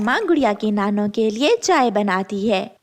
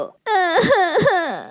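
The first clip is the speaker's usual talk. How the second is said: mocking laugh; 7 semitones higher than usual. Crow laughs after snatching.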